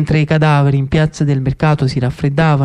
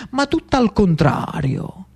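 ordinary delivery, nothing marked

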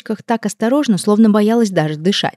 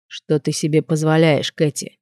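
slow, lightly lowered cold man; 3 semitones lower than usual. Acted. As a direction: ask coldly and slowly. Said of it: "холодным голосом произнёс он".

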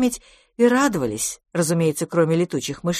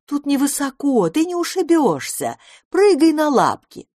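much higher, urging call; encouraging cry; 9 semitones higher, 4 dB louder than usual. A narrator's reading. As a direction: coax loudly from below. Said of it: "закричала Добронрава". (высокий подбадривающий крик)

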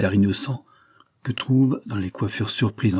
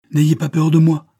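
panting words, louder, higher; out of breath; 7 semitones higher, 8 dB louder than usual. Acted. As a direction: call out while still gasping from the run.

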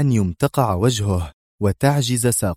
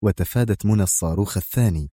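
ordinary delivery

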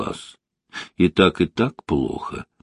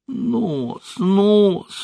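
mock yell; 11 semitones higher than usual. A narrator's reading. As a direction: draw out a high, hurt yell. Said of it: "заорал гиена".

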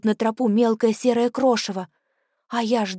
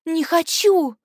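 desperate cry; 8 semitones higher, 3 dB louder, slower than usual. Acted. Terrified child cries out.

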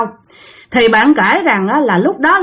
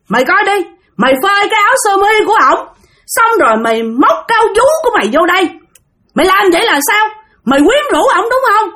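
furious accusation; 7 semitones higher, faster than usual. Retold or imitated. Older sister waving the shirt while accusing her younger sister. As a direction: shove the shirt in her face as you shout. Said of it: "nhào lại đằng cái góc kia".

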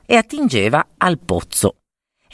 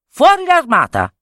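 commanding call; 10 semitones higher than usual. Acted. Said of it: "ti basterà comandare".

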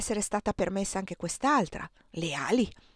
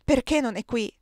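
higher-pitched, pleading exclamation; esclamazione accorata; 4 semitones higher, 4 dB louder than usual. A narrator's reading. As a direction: cry it out with mild distress. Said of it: "esclamò Erika". (esclamato con voce alta e accorata)

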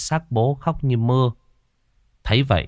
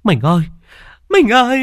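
rising anguished wail, louder and higher the second time; wailing cry; 14 semitones higher, 6 dB louder than usual. A narrator's reading. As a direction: cry out to the dead husband in despair. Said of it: "miệng kêu gào".

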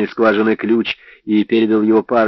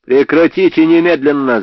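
yelled at the top of his lungs; 6 semitones higher, 6 dB louder than usual. A narrator's reading. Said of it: "заорал я во всё горло".

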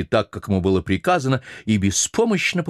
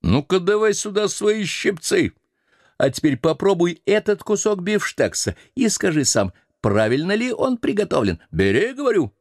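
high, commanding mate voice; bossy sailor; 11 semitones higher than usual. Acted. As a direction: lord it over the old cook. Stubb calls the cook back.

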